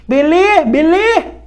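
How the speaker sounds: high, loud, punchy call; loud, high-pitched playful question; upbeat check-in. Real, not acted. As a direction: call out brightly to the class. Checking in as lesson wraps up.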